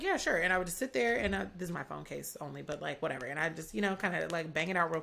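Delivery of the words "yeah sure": eager yes. Retold or imitated. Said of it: "And I'd be like".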